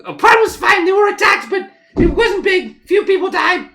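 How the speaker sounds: very high, loud squeaky chatter; squeaky halfling. Acted. Fleeing halfling answers travellers' questions, squeaky and loud. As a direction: talk loudly in a tiny, squealing voice. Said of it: "this kinda squeaky voice like".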